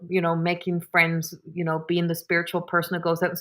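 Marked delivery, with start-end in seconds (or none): none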